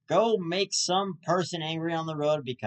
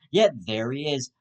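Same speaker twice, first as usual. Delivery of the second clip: usual speaking voice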